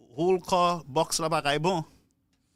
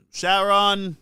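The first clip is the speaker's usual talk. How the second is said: calling out; 4 semitones higher, 8 dB louder than usual. Real, not acted. Calling out a listener's name.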